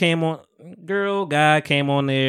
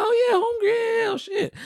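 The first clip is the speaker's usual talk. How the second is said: much higher, squeaky retold reaction; mock high-pitched realization. Retelling the guy recognizing her voice, high mock squeal. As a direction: play the guy's shocked dawning in a high squeak.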